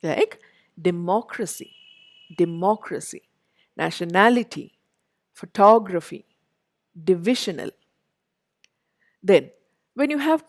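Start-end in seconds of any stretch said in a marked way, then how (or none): 0.9-7.7 "democracy, nationality, photography, divisional" stressed on MOC, NAL, TOG, VIS; de-MOC-racy, pho-TOG-raphy, as in "democracy", "photography"; third-from-end stress. Shown as a demonstration. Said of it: "third syllable".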